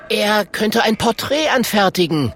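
put-on high-pitched child-like character voice; eager little dragon. Kokosnuss offers Klonk's skills to the bear, eager.

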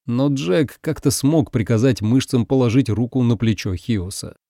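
ordinary delivery, nothing marked

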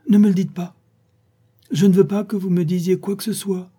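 high, urgent plea against spoilers; excited protest. Acted. Young reader begs her friend not to reveal the secret, excited.

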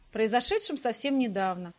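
plain reading voice

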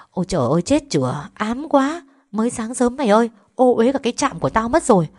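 high-pitched grumbling; indignant complaint. Acted. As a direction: scold as if jinxed first thing in the morning. Nurse complains at the commotion.

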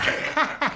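high, breathy sly giggle; a quick mischievous snicker; an airy teasing titter. Acted. Paravicini laughs at Mrs Boyle.

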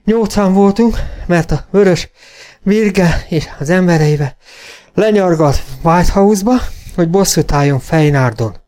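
high, halting, winded speech; breathless rider's report; panting. A narrator's reading. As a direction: catch breath between every few words. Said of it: "lihegte a karvajórú kóbor Jenny".

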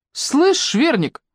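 calling out. A narrator's reading. Коваль calling out to Белла across the room, slow and high. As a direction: call her out, slow and cocky. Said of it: "позвала Ира Коваль".

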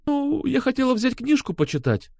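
high, fragile woman's voice; frightened woman. A narrator's reading. Алёна explains to Сергей, high and timid. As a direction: make a high, helpless excuse.

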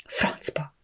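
whispered under the breath; muttered curse. A narrator's reading. Bianca curses alone in the bathroom, whispered. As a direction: hiss it under your breath, very soft. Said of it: "motyogtam az orrom alatt".

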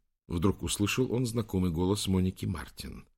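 plain reading voice

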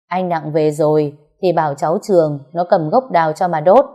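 ordinary delivery, nothing marked

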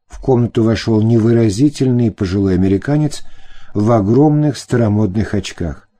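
ordinary delivery, nothing marked

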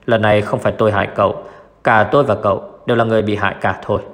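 ordinary delivery

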